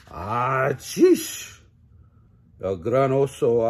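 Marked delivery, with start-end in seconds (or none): 0.1-1.6 loud sudden sneeze, ¡achish!; bear sneeze. A narrator's reading. The sleeping bear sneezes from pepper, loud sudden sneeze.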